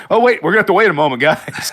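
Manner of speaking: high, fast and urgent; hurried mock voice. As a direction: rush it like an impatient player.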